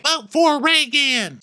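very high, drawn-out nonsense retort; Sutton. Acted. Sutton goading Diana, high silly declaration. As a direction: blurt it in a high slow voice. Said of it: "you be Sutton".

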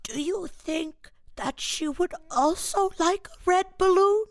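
a cute cartoon bear asking gently; tiny Pooh voice. [name] imitating the sweet bear in the movie trailer, high and slow. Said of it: "this little voice that was like".

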